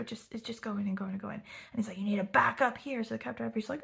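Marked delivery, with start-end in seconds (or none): 2.0-3.0 loud imitation of a bossy shout; Frankie. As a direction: call out the order, mock-angry. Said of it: "Frankie's, like, still shouting at him".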